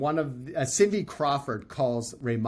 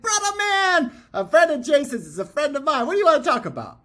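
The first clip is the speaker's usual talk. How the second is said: much higher, louder hammed-up surfer dude greeting; hyped surfer dude; 13 semitones higher, 7 dB louder than usual. Raymana greeting Chris on FaceTime, a hammed-up, much higher, louder surfer voice. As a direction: greet him like a long-lost brother. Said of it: "He's like".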